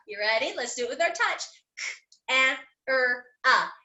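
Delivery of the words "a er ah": "A, er, ah" as separate sounds with gaps; sounded out. Shown as a demonstration. Teacher repeating camera's sounds for pupils, "A, er, ah" one by one. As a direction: isolate each sound in "A, er, ah". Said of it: "sand out the words one more time".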